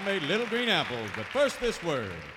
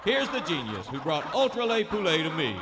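normal talking voice

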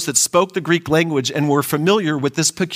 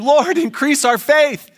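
higher, slow pleading cry; 8 semitones higher, slower than usual. Acted. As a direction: plead it upward, drawn out. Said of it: "the disciples cry out".

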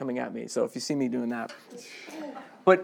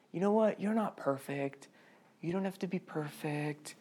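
much softer, coaxing tone; soft church reassurance; 4 semitones higher, 9 dB quieter than usual. Retold or imitated. Church telling believers they need not be perfect: soft coaxing. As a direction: soothe them like a gentle counsellor. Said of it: "and we say".

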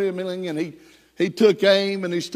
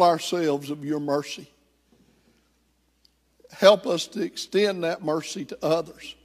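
closing prayer; 2 semitones lower than usual. Acted. Leads closing prayer over music.

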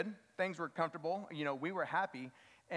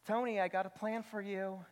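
put-on voice imitating his wife; hammed-up impression of a wife pitching her plan; mock wife voice; 3 semitones higher than usual. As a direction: playfully mimic your wife, not accurately. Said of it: "That's not how she sounds".

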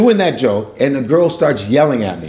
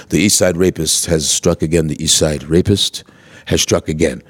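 low, measured newscaster voice; TV news anchor; 10 semitones lower than usual. Retold or imitated. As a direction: read it as a grave crime bulletin. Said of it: "I turn on T V".

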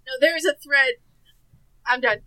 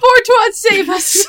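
desperate plea; 6 semitones higher, slower than usual. Real, not acted. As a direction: call out to be saved. Pleading for rescue, laughing.

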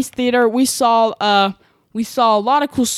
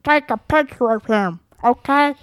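lightly voiced Kermit the Frog puppet; a hint of a cartoon frog voice. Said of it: "that's my Kermit the Frog voice".

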